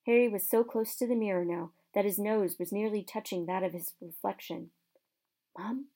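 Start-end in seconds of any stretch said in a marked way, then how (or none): 5.6-5.9 barely voiced, hushed call to his mother; hushed and hopeful; a soft hushed "Mom?"; soft whisper. A narrator's reading. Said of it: "he whispered".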